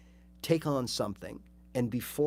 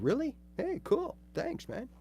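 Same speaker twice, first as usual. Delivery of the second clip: much higher, pleased disbelief; imitating his own thrilled reply, much higher; delighted surprise; 13 semitones higher than usual. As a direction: squeak with pleased surprise at the compliment. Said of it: "And I'm going".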